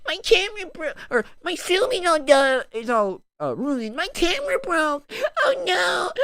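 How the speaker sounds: high-pitched mock crying; whiny brat. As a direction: blubber like a whiny kid, exaggerated. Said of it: "he's this whiny little".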